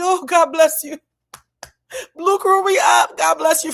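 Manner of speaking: high, loud ecstatic blessing; overjoyed thanks. Retold or imitated. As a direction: bless him like he saved your life.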